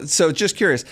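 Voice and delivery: ordinary talk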